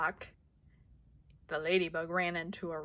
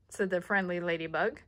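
plain reading voice

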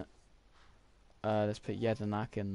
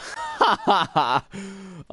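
burst of laughter; 9 semitones higher, 7 dB louder than usual. Real, not acted. Laughs at own mistake.

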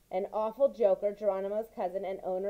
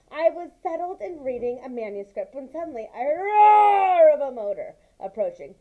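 lively, raised read-aloud voice for the mouse tale; story narration; 5 semitones higher, 8 dB louder than usual. A narrator's reading. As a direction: tell it like a bedtime story, with energy. Continues reading the story.

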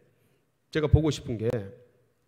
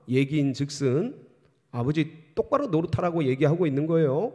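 normal talking voice

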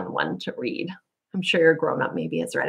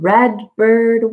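bright, higher and louder repeat; 4 semitones higher, 6 dB louder than usual. Shown as a demonstration. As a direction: lift it up loud on red bird. Sung story, loud echo.

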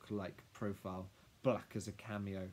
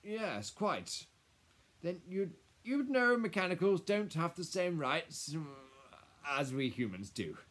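high, halting, officious explanation; flustered lawyer; 8 semitones higher, 8 dB louder than usual. Acted. Lawyer explaining mechanicals' rights to Lily, flustered. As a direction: hem and haw through the law.

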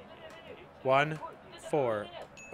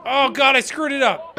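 dismayed outburst; 10 semitones higher, 9 dB louder than usual. Real, not acted. Mistake in game.

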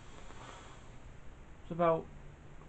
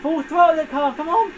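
triumphant yell; 11 semitones higher than usual. Real, not acted. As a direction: scream it at the finish. About to win race.

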